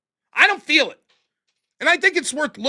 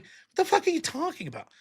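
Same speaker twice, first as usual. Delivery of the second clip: muttered disbelief; 4 semitones higher, 7 dB quieter than usual. Retold or imitated. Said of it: "I'm like".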